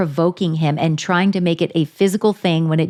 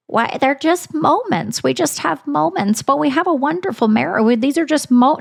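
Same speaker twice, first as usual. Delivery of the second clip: mimicking a reality star's crackly defense of her marriage; mock crackly voice; 6 semitones higher than usual. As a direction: imitate a wife's crackly excuse, pitched up and dragged out. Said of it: "in your crackly voice".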